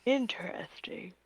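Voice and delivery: intrigued unvoiced whisper. Real, not acted. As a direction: hush it, intrigued. Intrigued reaction to horror domain.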